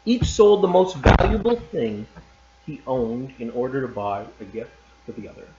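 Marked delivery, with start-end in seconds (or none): none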